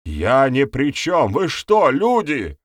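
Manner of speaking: high, loud pleading wail; panicked appeal climbing in pitch; wailing protest. A narrator's reading. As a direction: wail your innocence to the crowd. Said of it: "заголосил он".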